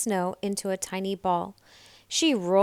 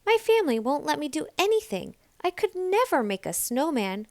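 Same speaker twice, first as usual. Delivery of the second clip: put-on fast, higher small girl voice; little Nell; 7 semitones higher, faster than usual. A narrator's reading. Little Nell tells the animals her family holds her back. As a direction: rush out the complaint.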